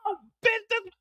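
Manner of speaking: high strained groaning curses; the hitman lying run over; pained cursing. Acted. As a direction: curse through broken ribs.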